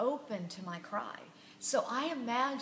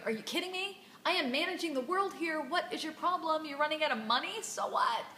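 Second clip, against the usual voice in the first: irritated rant; 5 semitones higher, faster than usual. Retold or imitated. Imagining herself as God, irritated by small troubles. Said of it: "I would be irritated going".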